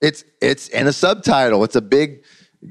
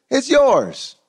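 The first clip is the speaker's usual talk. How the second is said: high, delighted reveal; spring the surprise on him; son revealing gift; 12 semitones higher than usual. Retold or imitated. Son telling his dad the truck is his, raised and delighted.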